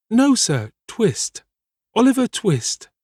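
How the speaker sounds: put-on high little boy's voice; young Oliver. A narrator's reading. Boy corrects his host about his name, high-voiced.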